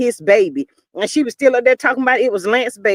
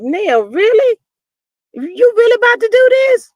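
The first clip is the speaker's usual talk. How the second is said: high, squeaky, louder "really"; mock disbelief; 10 semitones higher, 3 dB louder than usual. The host reacting to Nell, high mock disbelief. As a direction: act stunned at her nerve. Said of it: "I was like".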